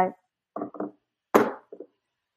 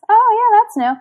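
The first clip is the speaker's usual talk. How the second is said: mock excitement; 12 semitones higher, 5 dB louder than usual. Mocking new-parent excitement.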